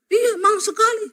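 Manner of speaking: high, teasing agreement; sly mock agreement. Wife teasingly agrees with her complaining husband, high and sly. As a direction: agree sweetly while needling him.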